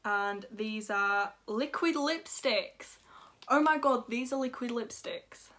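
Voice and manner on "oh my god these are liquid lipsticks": real excited gushing, higher and louder; thrilled outburst with a big gasp. Real, not acted. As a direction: gasp and burst out with delight at the find. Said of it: "I get very excited".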